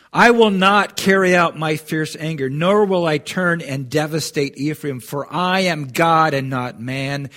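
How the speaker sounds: preached with full force; forceful scripture reading. Acted. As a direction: declare it like God speaking from the pulpit. Preacher reads God's words aloud.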